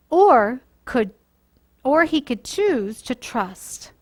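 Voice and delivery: put-on storytime voice for children, lively and clear; gentle storyteller reading to kids; story narration. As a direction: read it like a bedtime Bible story.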